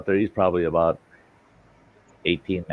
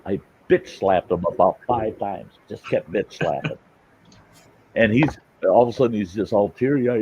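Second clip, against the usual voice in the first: usual speaking voice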